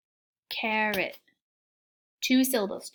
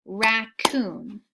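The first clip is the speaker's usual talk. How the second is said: Raccoon said slowly as rac-coon, two clapped beats; clapped syllables; 3 dB louder than usual. Shown as a demonstration. Teacher to class, clapping Raccoon out in two beats. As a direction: split Raccoon into two even claps. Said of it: "Do it with me".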